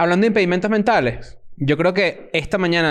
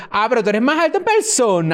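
higher-pitched imitation of a gushing stranger; mock fan remark; 6 semitones higher than usual. Comedian mimicking people he met on tour, mock fan remark. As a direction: imitate a fan, voice lifted high. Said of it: "la otra que era como".

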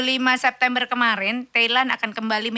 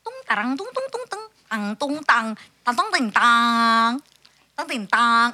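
mock dial tune.

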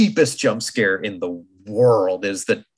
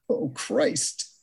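mock dread; 8 semitones higher, much slower than usual. Mock dread at daughter's remark.

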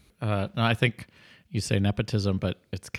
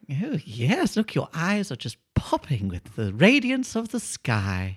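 put-on high, sweet old lady voice; 5 semitones higher than usual. Old lady happily answering the burglar, high sweet voice. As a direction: play a doting old lady.

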